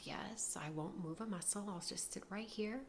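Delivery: very soft, obedient little voice; meek compliance. Retold or imitated. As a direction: answer timidly, barely above a murmur. Meekly obeys the policeman.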